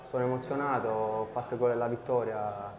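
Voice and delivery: usual speaking voice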